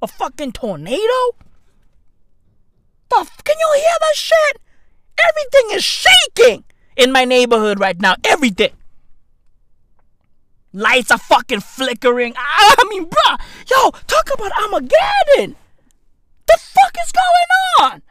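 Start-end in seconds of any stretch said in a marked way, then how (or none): 3.1-6.6 mock panic. Panic at a noise outside. 12.4-18.0 panicked shout. Acted. Panic at a noise outside.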